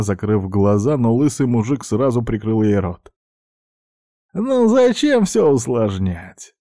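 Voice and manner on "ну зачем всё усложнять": high, drawn-out exasperated whine; irritated sigh. A narrator's reading. Thug gripping the struggling girl's face, irritated. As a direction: stretch it out, fed up with her. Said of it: "раздражённо вздохнул Джек".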